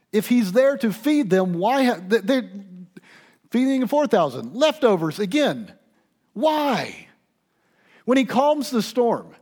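high, loud, driving sermon climax; full-force preaching. Acted. Pastor preaching to congregation, loud passionate build.

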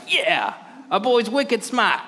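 high, slow, hammed-up Boston accent cheering; mock Boston cheer. Disciples cheering Jesus on, mock Boston accent. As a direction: gush it like a Boston buddy. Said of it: "Peter and John would have been behind him being like".

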